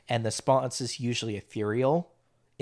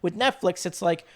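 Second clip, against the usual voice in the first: ordinary delivery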